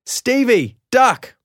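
put-on high, loud shout to the boy; yelling warning. A narrator's reading. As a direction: yell the warning mid-jump.

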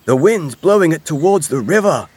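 high, loud, urgent and puffed; puffing. A narrator's reading. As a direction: call out while running along. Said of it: "puffed Badger".

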